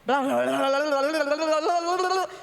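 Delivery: loud, rattled-off blah blah prayer; mock rattling prayer. Shown as a demonstration. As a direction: rattle it off without pausing to listen. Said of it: "Só falo". (reza falada de enfiada, alta, sem pausa)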